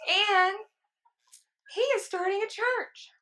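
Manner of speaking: high, loud mock old-lady voice; imitating older woman. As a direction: squeal it out like a doting older lady. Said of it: "And she's like".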